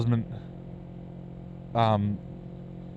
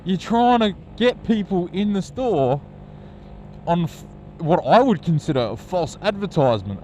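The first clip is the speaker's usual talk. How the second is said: indignant; 7 semitones higher than usual. Retold or imitated. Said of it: "I'm thinking".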